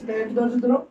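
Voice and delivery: ordinary talk, nothing marked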